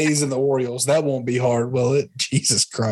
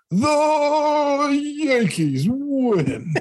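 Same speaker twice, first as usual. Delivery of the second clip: drawn-out, very high, sing-song home-run call; John Sterling call; much slower than usual. Retold or imitated. Imitating the radio man's famous Yankees victory call, stretched and sung out. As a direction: stretch the win out like a radio announcer celebrating. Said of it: "He's the".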